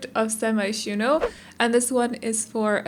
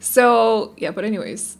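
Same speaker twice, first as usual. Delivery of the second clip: normal talking voice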